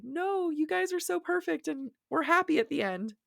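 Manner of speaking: high-pitched mock plea of a devoted reader; protesting reader. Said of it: "hard for a reader of being like".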